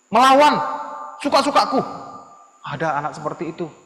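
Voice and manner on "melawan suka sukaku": high, loud, punched words; defiant outburst. Acted. Child defying parents, loud and wilful. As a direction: throw it out loudly in defiance.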